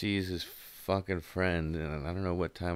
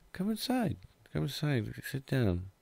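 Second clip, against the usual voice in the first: gentle; 8 semitones higher than usual. Retold or imitated. Mom calmly ushering him.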